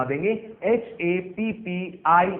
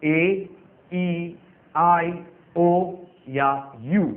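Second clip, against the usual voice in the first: vowels named slowly one by one; slow listing; 2 semitones higher, much slower than usual. Shown as a demonstration. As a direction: list the vowels for the class. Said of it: "vowel का मतलब हो गया".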